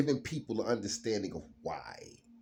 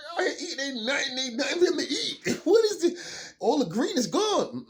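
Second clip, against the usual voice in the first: very high, loud squeaky critter voice; bunny's voice; 12 dB louder than usual. Retold or imitated. Voicing a bunny in winter, high and squeaky. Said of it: "you be like".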